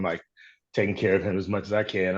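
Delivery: normal talking voice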